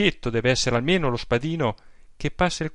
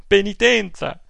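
louder, higher outcry of Penitenza!; indignant outburst; 8 semitones higher, 6 dB louder than usual. Acted. Aunt scorning the priest's order, Penitenza! shouted out.

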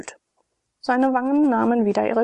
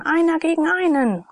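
gladiators bellowing; 8 semitones higher, 4 dB louder than usual. A narrator's reading. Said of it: "brüllten die Gladiatoren".

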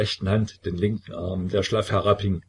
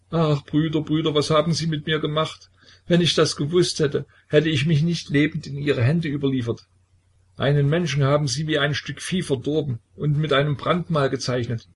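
wailing lament; 7 semitones higher than usual. A narrator's reading. Petka wailing at the elders who branded him, high and tearful. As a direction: wail at them like a wronged child. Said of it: "jammerte Petka".

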